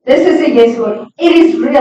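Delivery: ordinary delivery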